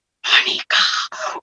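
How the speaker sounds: "Monika" breathed as a slow soft whisper; whispered name. Retold or imitated. Baba's voice whispering "Monika" into her ear, hushed. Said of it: "एकदम जैसे".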